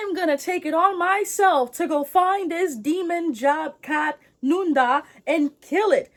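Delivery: high, loud, dramatic declaration; mock heroic vow. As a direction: proclaim it like a young hero. Acted son vows revenge.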